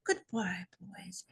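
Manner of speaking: put-on no accent, fading from soft to a whispered "boys"; dying farewell. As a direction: let "Goodbye, boys" die away into a whisper. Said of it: "I can't do a Scottish accent".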